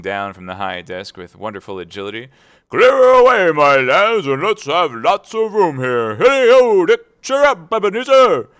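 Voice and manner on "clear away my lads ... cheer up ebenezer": much higher, much louder hearty calls; rally the lads for the party; jovial shouting Fezziwig. A narrator's reading. Fezziwig voiced in story.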